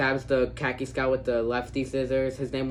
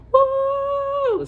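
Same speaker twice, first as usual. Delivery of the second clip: very high, loud whoop; whooping cry; 6 dB louder than usual. Retold or imitated. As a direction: let out a joyful whoop. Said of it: "they just go".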